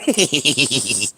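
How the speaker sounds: rapid high giggle trailing down. Real, not acted. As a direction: giggle to yourself. Laughs by themselves.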